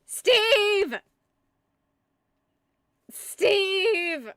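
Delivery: long, loud, desperate call across the distance; yelling like Wilson. Pretend. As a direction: call for Steve like a lost friend on the beach. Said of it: "I'm trying to do it like Wilson".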